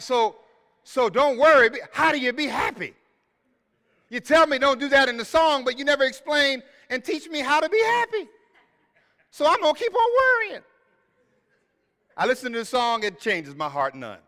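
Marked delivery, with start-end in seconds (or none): 0.0-10.6 high, fast, louder complaining whine rising at the end; frustrated retort. Retold or imitated. Preacher playing his frustrated self talking back to the song, whiny. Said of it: "I'm like".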